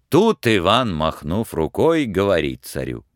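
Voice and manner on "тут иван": usual speaking voice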